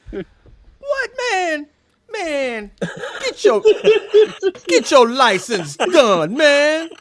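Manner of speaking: high, laughing cop voice, loud at the end; easygoing cop. Retold or imitated. Cop telling Jay to fix his license, high and laughing. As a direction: laugh through a lax cop's warning.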